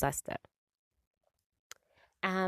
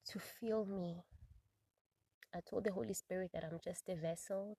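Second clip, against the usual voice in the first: hushed, much softer prayer, near-whispered pleas; closing prayer; 13 dB quieter than usual. Real, not acted. Host closes podcast praying over listeners, hushed and soft. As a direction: pray softly, slowing on the pleas.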